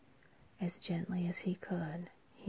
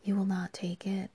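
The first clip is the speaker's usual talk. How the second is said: piteous question; 2 semitones higher, 7 dB louder than usual. Acted. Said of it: "she asked piteously".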